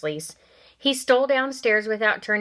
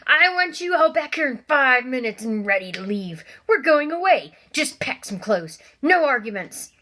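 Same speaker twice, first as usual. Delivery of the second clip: barely controlled anger; 5 semitones higher, 4 dB louder than usual. Acted. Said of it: "trying to speak calmly".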